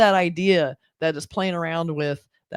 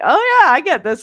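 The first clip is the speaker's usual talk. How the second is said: knowing shrug; 9 semitones higher, 8 dB louder than usual. Retold or imitated. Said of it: "this was very like".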